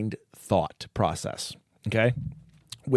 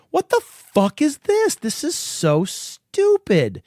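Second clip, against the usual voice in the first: higher, slow incredulous rant; mock outraged disbelief at a movie; retold outrage; 13 semitones higher, slower than usual. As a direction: replay your disgust at the ending. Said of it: "I'm like".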